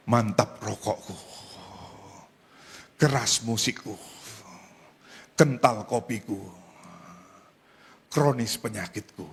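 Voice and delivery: acted contented smoker, long pauses and puffs; savouring smoker. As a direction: draw out each phrase, then exhale smoke. Acts smoker savouring his puffs.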